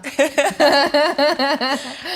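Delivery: bursts into a big laugh; loud, high laugh. Real, not acted. Laughing at her own joke.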